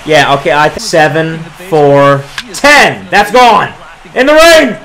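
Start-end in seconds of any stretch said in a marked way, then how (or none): 4.2-4.8 high thrilled shout; excited call. Real, not acted. Gamer calling his homer in the rain. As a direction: cheer it out.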